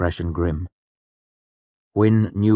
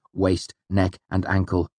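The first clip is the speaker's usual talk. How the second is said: ordinary delivery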